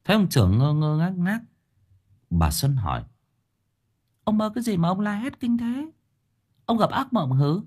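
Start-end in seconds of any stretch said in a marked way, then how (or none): 4.2-7.6 high, soft put-on woman's voice; concerned wife. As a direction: ask as a puzzled wife, pitched high. Voices the wife questioning her husband. (giọng bà vợ cao, hỏi han chồng)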